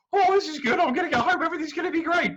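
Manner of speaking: high, loud, self-satisfied hero voice; a dim hero pleased with himself; smug hero. Acted. Perseus congratulating himself on the way home, high and smug.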